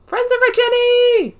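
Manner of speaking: very high, loud squeal of delight; excited squeal. Real, not acted. Gamer spots a new item, squealing with delight. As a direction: squeal at the find as if it's a treasure.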